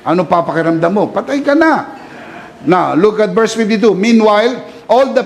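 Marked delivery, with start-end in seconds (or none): 1.1-2.0 high, shrieked alarmist verdict; panicky gossip. Retold or imitated. Preacher mocks a fearful friend, high panicky alarm. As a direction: cry it out like a scaremonger.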